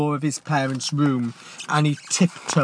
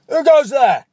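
loud, high shouted challenge; sentry's challenge; 13 dB louder than usual. Acted. Night sentry calling out into the darkness, shouted challenge. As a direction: bark it into the dark like a guard on watch. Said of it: "challenged a voice from the darkness".

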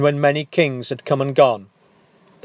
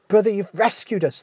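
joyful cry; 7 semitones higher, faster than usual. Acted. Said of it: "they cried".

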